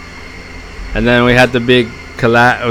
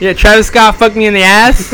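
mock drive-thru order; 7 semitones higher than usual. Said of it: "And then Ivan goes".

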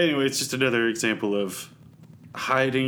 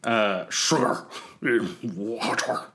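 slow, stretched alien voice; slinky. Acted. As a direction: stretch the words out slowly. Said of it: "That was actually really good".

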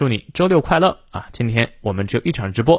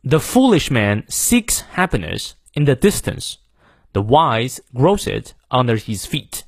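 slow, punch "foolish", "seeks", "wise", "grows", "feet"; slow American English demo with strong stress on "foolish" and "grows"; American model reading, stressed content words; slower than usual. Shown as a demonstration. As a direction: hit the content words, glide weakly over "the", "in", "it". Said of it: "美式发音我们来念两遍，仔细听我的重读".

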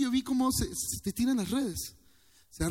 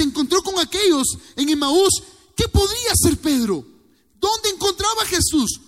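louder, much higher fervent preaching; preaching at full force; 9 semitones higher, 7 dB louder than usual. Real, not acted. Pastor preaching fervently to his church, louder and higher. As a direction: preach it out with fire.

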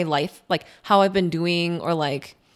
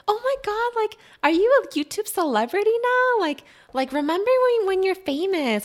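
much higher, sing-song fake-excited voice; mocking gushing acquaintance; 12 semitones higher than usual. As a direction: ham up the fake awe. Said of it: "they're like".

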